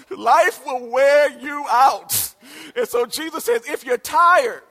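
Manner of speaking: loud, slow preacher's cry; preaching shout. Acted. Pastor proclaims to the church, loud and slow. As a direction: hammer each word home.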